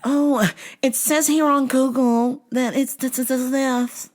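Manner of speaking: high, slow put-on clerk; disguised phone voice. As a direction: feign helpfulness in a drawn-out high voice. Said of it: "I'm gonna change my voice every time you call".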